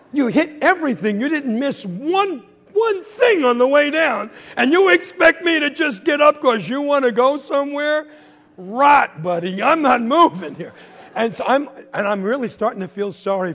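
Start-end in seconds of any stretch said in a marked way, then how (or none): 0.1-10.7 raised, exasperated scolding; refuse, loud and fed up; indignant body. Acted. Body answering its owner after the fall, indignant protest. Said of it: "my body was trying to say to me".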